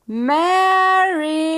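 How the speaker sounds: long, ringing joyful call. A narrator's reading. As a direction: call his bride across the square. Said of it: "he called her name".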